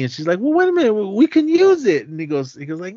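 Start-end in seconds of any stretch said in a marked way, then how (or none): 0.4-2.1 very high, louder pleading wife; protesting wife. Retold or imitated. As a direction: imitate a wife arguing back. Wife arguing to keep the money.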